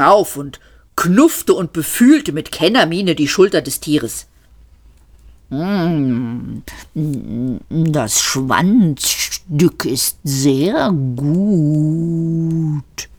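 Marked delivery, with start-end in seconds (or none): 5.5-13.1 sehr langsam, tief brummendes Rind. A narrator's reading. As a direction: brumm es behaglich. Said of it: "brummte das Tier". (das Tier lobt sein Schwanzstück brummend)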